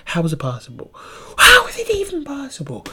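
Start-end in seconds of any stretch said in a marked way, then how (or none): 1.4-2.8 high exaggerated complaint about the noise; mock exasperated squeal. Shown as a demonstration. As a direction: squeal it in comic disbelief. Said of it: "How the fuck can people be so goddamn loud".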